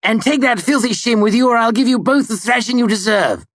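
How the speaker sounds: high, loud menacing roar; furious threat. A narrator's reading. Ambrose shouting at him in the street, enraged threat. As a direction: threaten him at full fury.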